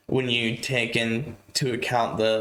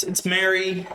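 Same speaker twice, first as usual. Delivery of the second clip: shouting; 6 semitones higher than usual. A narrator's reading. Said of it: "So everyone shouts".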